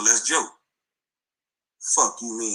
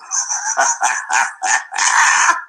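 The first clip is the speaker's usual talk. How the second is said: short laugh. Real, not acted. Laughing at the other side.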